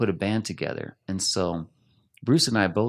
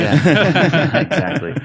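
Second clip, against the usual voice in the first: laughing out loud, high; bursting into laughter; 10 semitones higher, 13 dB louder, much slower than usual. Real, not acted. Laughs at being called out.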